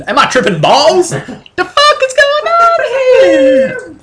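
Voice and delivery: high, drawn-out baffled cry; stunned disbelief. Acted. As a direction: squeal it out, totally bewildered. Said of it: "Are you tripping?".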